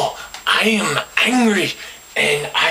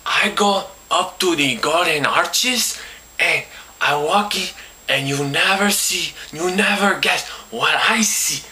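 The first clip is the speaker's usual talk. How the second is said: ordinary talk, nothing marked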